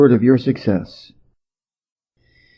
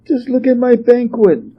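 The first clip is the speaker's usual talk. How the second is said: put-on high dismayed old queen voice; an aghast royal lady, pitched high; crying queen mother; 11 semitones higher than usual. A narrator's reading. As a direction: wail it as an upset queen. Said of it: "cried the Lady Queen Mother".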